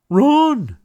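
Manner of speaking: high, loud frightened yell; panicked shout. Acted. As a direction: scream at everyone to flee. Goose fleeing in panic.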